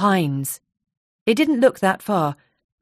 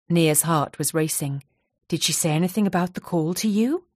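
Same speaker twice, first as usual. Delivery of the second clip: plain reading voice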